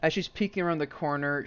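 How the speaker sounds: ordinary delivery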